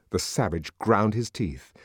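ordinary delivery

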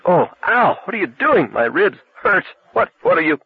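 high, panicked cries of pain; pained cry. Acted. Lee crushed in a bear hug, pained high cries. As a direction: yelp as the ribs are crushed.

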